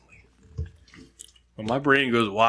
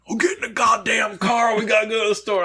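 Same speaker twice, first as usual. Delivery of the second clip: high, loud mock yell; hammed-up wrestler shout; Stone Cold yelling; 11 semitones higher, 4 dB louder than usual. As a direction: order him into the car, shouting. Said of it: "I go".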